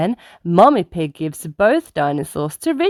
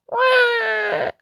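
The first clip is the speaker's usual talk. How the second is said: mock toddler wail. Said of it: "George cries".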